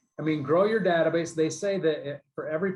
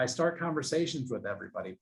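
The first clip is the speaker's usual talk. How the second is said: ordinary talk, nothing marked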